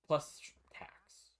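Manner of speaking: a hushed, whispered add-on; whispered aside. Real, not acted. Streamer adding to what the game cost, whispered. Rueful aside about money spent.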